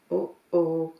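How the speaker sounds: ordinary delivery, nothing marked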